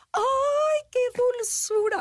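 high, gushing cooing voice; gushing adults. Retold or imitated.

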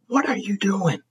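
incredulous. Retold or imitated. Confronting roommate.

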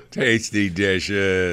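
laughing. Real, not acted. Laughingly repeats co-host's words.

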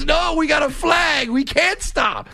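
hammed-up rocker shouting he can't stop. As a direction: refuse like a rock star mid-show. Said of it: "And he goes".

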